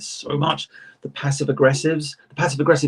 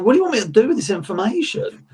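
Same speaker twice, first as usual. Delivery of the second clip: high-pitched, exasperated disbelief; mock incredulous; 9 semitones higher than usual. Artist to boastful stranger left standing there, mock incredulous. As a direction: throw up a baffled, high-voiced question.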